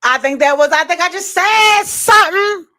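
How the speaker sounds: high, loud proud exclaim; thrilled with yourself; excited. Real, not acted. Impressed by own quote.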